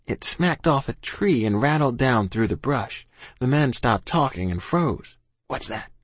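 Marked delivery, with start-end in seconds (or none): 5.5-5.9 hushed, wary hiss; low-volume hissed question. A narrator's reading. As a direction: hiss it softly to the others. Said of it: "one of them hissed".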